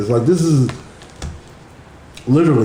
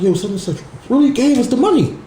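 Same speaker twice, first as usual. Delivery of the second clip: high, excited retelling of the call; excited disbelief; 9 semitones higher than usual. Retold or imitated. Friend phoning mid-tension to say the donation came, high excited voice. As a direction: pitch it way up as if the news just landed. Friend calls with shocking good news.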